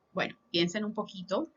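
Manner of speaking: ordinary delivery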